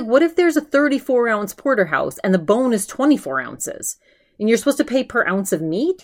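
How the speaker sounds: imitating an outraged rant; mock indignant, pitch up. Ben ranting about the bony steak, mock outrage. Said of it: "he's outraged at the price".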